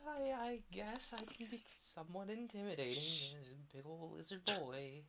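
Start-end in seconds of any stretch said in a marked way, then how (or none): none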